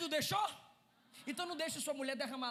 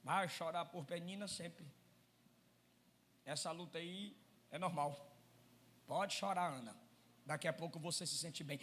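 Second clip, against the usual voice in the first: lightly voiced careless husband; 8 semitones lower, 12 dB louder than usual. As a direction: shrug off her tears, resigned and low. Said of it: "Ele poderia dizer".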